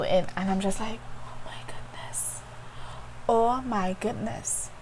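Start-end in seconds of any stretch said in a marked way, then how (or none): 1.2-4.7 whispered, slow, overcome; awed whisper. Retold or imitated. Teacher overcome by a child's drawing, whispering. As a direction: breathe it out in disbelief.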